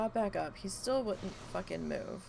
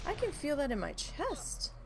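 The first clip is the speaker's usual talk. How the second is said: shocked; 6 semitones higher than usual. Real, not acted. Loud car stereo outside.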